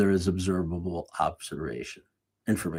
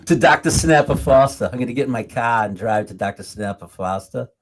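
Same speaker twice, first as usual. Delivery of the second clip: put-on Boston accent; 3 semitones higher, 7 dB louder than usual. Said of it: "terrible Boston accent".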